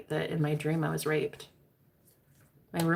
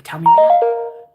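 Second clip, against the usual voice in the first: slow and much louder; insistent demand; 14 dB louder, slower than usual. Retold or imitated. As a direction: demand it, each word pressed. Demanding he repeat it.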